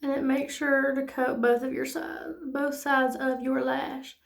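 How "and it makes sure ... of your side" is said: ordinary talk, nothing marked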